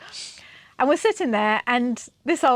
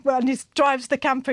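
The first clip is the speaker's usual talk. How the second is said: ordinary talk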